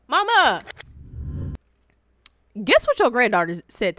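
loud holler, high on the first word; tattling call. Retold or imitated. Aunt telling grandma on her niece, mock tattling holler.

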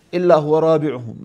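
slow, melodic Quran recitation; Quranic recitation. Acted. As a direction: chant the verse slowly.

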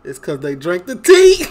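loud gleeful echo exploding on "tea"; hammed-up shouted punchline; mock shout. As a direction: build to a yell on the last word. Gleefully echoing the British meme clip.

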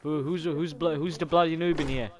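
lightly put-on Aussie accent, bloody and mate; hint of Australian accent; mock Australian accent. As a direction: play an Aussie bloke in a game lobby. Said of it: "I'm bloody Australian".

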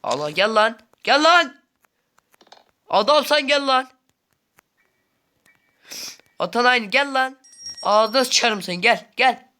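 high, loud angry taunting; angry challenge. Real, not acted. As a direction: yell to provoke the attacker. Challenging enemy in game.

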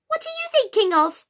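high, loud, indignant mouse; severe. A narrator's reading. Mouse questioning inattentive Alice, severely. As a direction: demand it severely in a piercing little voice. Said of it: "said the mouse to Alice severely".

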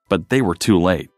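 usual speaking voice

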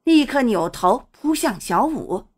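ordinary delivery

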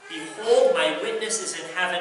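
usual speaking voice

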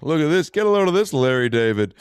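high, amused heckling call; imitating a friend pointing out a celebrity; Harlan's teasing voice. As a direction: nudge a buddy, lightly mocking. Harlan voiced spotting Larry.

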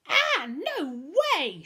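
loud, high, drawn-out squeal of delight; delighted shriek. A narrator's reading. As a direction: shriek it slowly with utter joy. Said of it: "she shrieked with delight".

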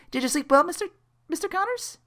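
very high, sugary morning greeting; sweet landlady. Retold or imitated. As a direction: chirp it like a cheery old hostess.

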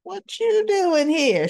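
high, slow startled question; mock shock at seeing him; surprised. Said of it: "Carly looks at him like".

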